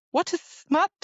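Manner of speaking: high, wide-eyed innocent voice; innocent question. Retold or imitated. Gwen innocently asking what smut is, high and naive. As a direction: ask it like a naive girl. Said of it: "Gwen asked".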